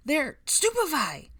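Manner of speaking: high, drawn-out battle yell; loud urgent cry; shouted spell. Acted. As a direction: cast it loudly mid-fight. Spell cast in a fight.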